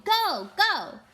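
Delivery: loud, high urgent shout. Acted. Diggory shouting to Polly mid-struggle, loud. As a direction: yell it with all your strength. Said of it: "shouting to Polly".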